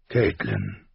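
low, cool formal greeting. A narrator's reading. Husband greets his wife by the pond, cool and formal. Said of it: "Seine Stimme klang kühl und förmlich".

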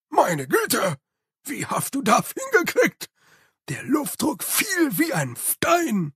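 high, delighted servant voice; surprised Igor. Acted. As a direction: Igor catching a jolt. Igor, amazed. (hohe, überraschte Igor-Stimme)

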